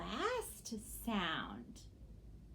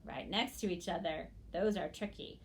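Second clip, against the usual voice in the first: normal talking voice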